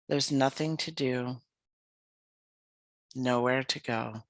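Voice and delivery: calm, unhurried yoga nidra voice with long pauses; guided meditation. Acted. Leads a guided relaxation practice.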